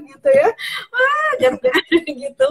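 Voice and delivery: high laughter breaking through the words; real burst of laughter; laughing. Real, not acted. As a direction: laugh it out, half crying. Laughs at own outburst.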